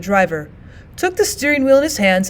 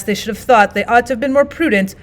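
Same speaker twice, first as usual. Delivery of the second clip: plain reading voice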